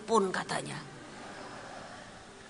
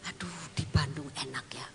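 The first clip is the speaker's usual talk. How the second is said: soft, whispered wishful sigh; wistful inner whisper; whispered thought; 3 semitones lower, 7 dB quieter than usual. Retold or imitated. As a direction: whisper it to yourself. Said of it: "Kalau saya mikir gini".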